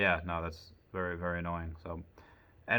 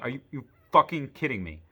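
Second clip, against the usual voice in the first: imagined outburst; 4 semitones higher, 4 dB louder than usual. Retold or imitated. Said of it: "I didn't say that".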